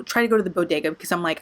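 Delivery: ordinary delivery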